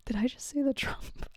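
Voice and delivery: hushed, amused self-check; a quick embarrassed whisper; whispered aside. Real, not acted. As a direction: whisper it to yourself, caught out. Catches her own slip.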